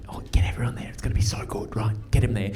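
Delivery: whispered, secretive plotting; conspiratorial whisper. Retold or imitated. Friend whispering party plans, a conspiratorial whisper. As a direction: whisper it like a surprise plan.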